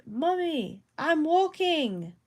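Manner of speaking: high-pitched mock British child's call; British accent. Bendigo doll going off in the Willits household, British accent. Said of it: "saying this in a British accent".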